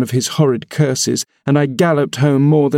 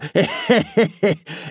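put-on crackly, gloating old man's laugh; malicious cackle; 6 semitones higher, 3 dB quieter than usual. A narrator's reading. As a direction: cackle at his misery. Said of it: "malignant, crackly laugh".

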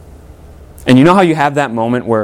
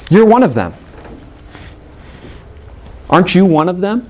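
lightly acted accuser, pitched up high; higher, pointed challenge, mildly acted; accusing servant; 6 semitones higher than usual. Acting servants who challenge Peter.

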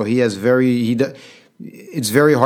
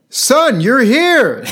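much higher and louder, gleeful greeting of a forgetful dad; delighted old father; 12 semitones higher, 5 dB louder, much slower than usual. Retold or imitated. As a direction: greet your son as if seeing him for the first time. Father greets son a third time.

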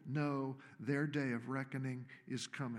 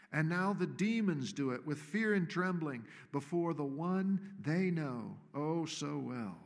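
ordinary delivery

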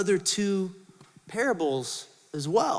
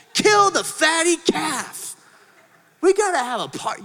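high, loud, slow order to kill the calf; joyful shout; 8 semitones higher, 3 dB louder than usual. Acted. Father calling to servants to prepare the feast, shouting. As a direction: shout it out in delight.

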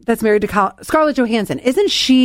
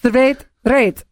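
attempted Dutch that comes out Russian; faintly Russian-sounding try at Dutch; unintended hint of a Russian accent; accidental Russian accent. Real, not acted. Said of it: "a Russian accent".

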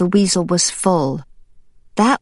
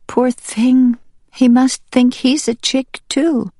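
pitying hen; 8 semitones higher than usual. Acted. Onlooker pities Baby.

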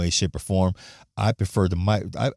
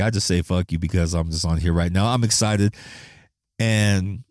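ordinary talk, nothing marked

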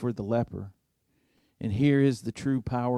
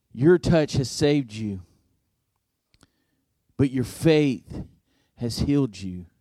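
louder, deliberate sermon voice; emphatic preaching; 4 dB louder than usual. Acted. Preaching a point to congregation.

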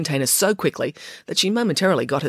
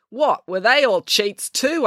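incredulous; 8 semitones higher, slower than usual. A narrator's reading. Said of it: "asked April".

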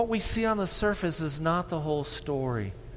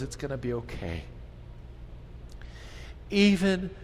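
closing prayer; 3 semitones lower than usual. Acted. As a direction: pray it gently, slowing toward amen.

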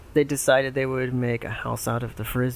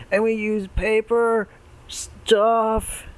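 frat bro; 8 semitones higher, much slower than usual. Acted. Pig two voiced.

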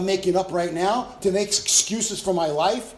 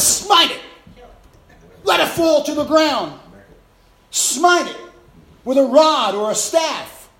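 loud, higher preaching shout; 6 semitones higher, 6 dB louder, slower than usual. Real, not acted. Preacher shouting God's command to smite the mosquito. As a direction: drive God's command home.

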